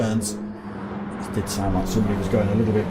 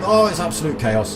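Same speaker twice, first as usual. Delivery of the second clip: higher and louder, thrilled at the crash; excited; 8 semitones higher, 6 dB louder than usual. Real, not acted. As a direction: burst out with excitement. Crash during race commentary.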